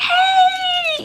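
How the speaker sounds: high, loud delighted call; joyful mock call to a little student; excited greeting. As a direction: shout hello across the hall to a child.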